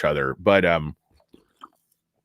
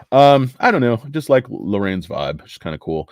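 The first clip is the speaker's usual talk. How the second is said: ordinary talk, nothing marked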